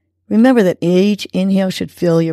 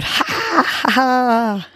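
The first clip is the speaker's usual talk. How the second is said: high, breathy fake laugh that tips into a real one; forced laughter demo; 4 semitones higher, much slower than usual. Shown as a demonstration. Host leading listeners in laughter breathing, forced laugh. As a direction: start the laugh on purpose and let it catch. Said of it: "Go ahead and try it".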